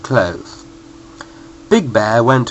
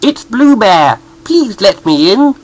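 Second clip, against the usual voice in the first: odd, higher voice for a yellow bear; yellowy voice; 8 semitones higher, 5 dB louder, slower than usual. A narrator's reading. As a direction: sound strangely yellow. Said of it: "in a yellowy sort of voice".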